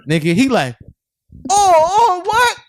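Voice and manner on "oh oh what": mock shock. Shocked reaction in retold scene.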